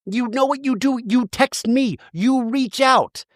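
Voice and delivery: high-pitched, aggrieved imitation; mock indignation. Recapper acting out housewife defending her phone calls, mock indignant. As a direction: protest in a put-on high voice, stretching endings.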